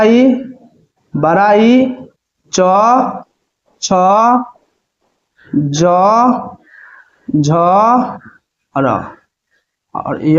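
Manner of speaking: very slow, spelling out each letter; teacher slowly dictates letters to students, very slowly; slow dictation. Shown as a demonstration. Teacher धीरे-धीरे अक्षर बोलकर लिखवाती है. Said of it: "teacher dictating letter list".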